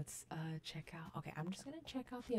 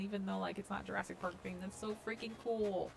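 usual speaking voice

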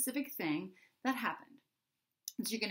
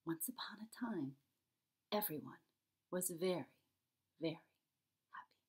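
hushed whisper, fading softer; 8 dB quieter than usual. Acted. Charlie whispers a campfire story to his family, hushed. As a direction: breathe it like a secret by the campfire. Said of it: "Charlie whispered".

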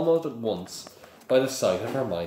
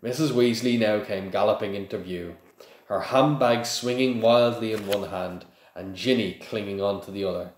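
ordinary delivery

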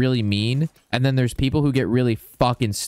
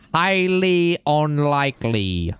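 slow, drawn-out put-on voice; mock dramatic repeat; 2 semitones higher, much slower than usual. Shown as a demonstration. Streamer repeating his own line in a mock dramatic voice. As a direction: stretch it out theatrically.

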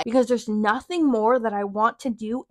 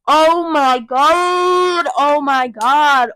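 a drawn-out, louder "oh my God"; exasperated; 3 semitones higher, 5 dB louder, much slower than usual. Real, not acted. Fuming vlogger recalling the coward, slow and louder. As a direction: stretch it out in disbelief.

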